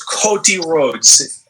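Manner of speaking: put-on botched German accent, very slow; Gunther. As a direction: stretch out a German accent that slips into Swedish. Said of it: "that sounds so Swedish".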